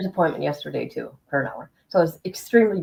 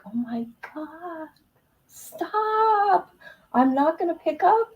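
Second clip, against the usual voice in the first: exasperated; 8 semitones higher, much slower than usual. Retold or imitated. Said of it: "I'm like".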